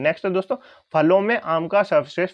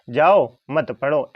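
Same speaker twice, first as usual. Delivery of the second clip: drawn-out loud जाओ, मत पढ़ो run together; pause after जाओ; 5 dB louder, much slower than usual. Shown as a demonstration. Teacher repeating what child understood, pause after जाओ. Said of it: "ऐसा कौन समझ रहा है".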